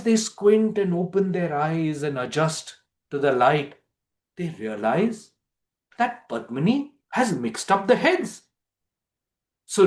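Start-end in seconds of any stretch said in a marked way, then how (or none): none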